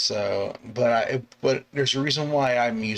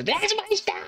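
high-pitched screech imitating a passenger; very high mock scream; retold passenger scream. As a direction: scream it out like a panicked rider, hammed-up. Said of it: "somebody screams it".

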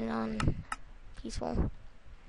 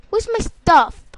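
frustrated shout; 8 semitones higher, 11 dB louder, slower than usual. Real, not acted. Lost his items.

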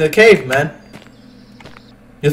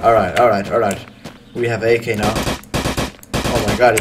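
normal talking voice

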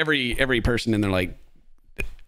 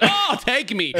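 high-pitched mock swoon; imitated smitten woman; 13 semitones higher, slower than usual. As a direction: swoon as a woman won over instantly. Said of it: "And she's like".

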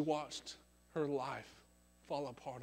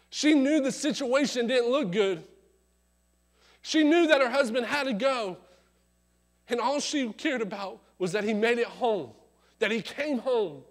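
loud, higher preaching build; 6 semitones higher, 9 dB louder than usual. Acted. Preacher building the story to his congregation, fervent. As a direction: preach it with full force.

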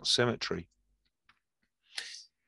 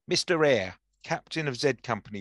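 ordinary talk

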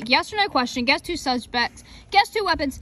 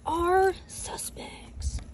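whisper; 4 semitones higher, 6 dB quieter, much slower than usual. Acted. Spooky reveal of suspect cards.

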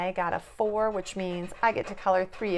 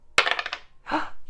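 a big, breathy gasp of delight; excited gasp. Acted. Parent reacting to a lucky roll, excited gasp.

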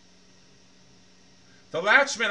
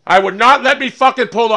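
loud, higher-pitched angry yelling at the game; furious rant; 7 semitones higher, 13 dB louder than usual. Real, not acted. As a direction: rage at the car that won't pull over. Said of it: "I was so fucking pissed".

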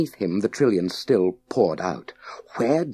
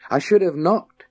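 a cheeky quip, raised high and louder; 10 semitones higher, 5 dB louder than usual. Acted. Scott jokes to the unseen Masters, cheeky. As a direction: toss it off with a cheeky grin. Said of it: "he said out loud, cheekily".

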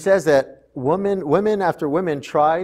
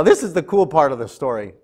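ordinary talk, nothing marked

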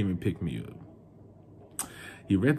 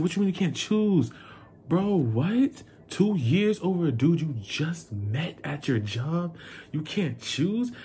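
high-pitched retold disbelief; incredulous; 7 semitones higher than usual. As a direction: voice shoots up in outraged questioning. Acted disbelief at partner's indecision.

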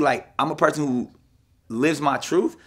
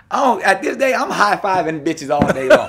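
higher, louder, laughing through it; laughing mock greeting; 8 semitones higher, 11 dB louder, slower than usual. Guest acting out nervous greetings to women, laughing. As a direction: squeal it out between laughs.

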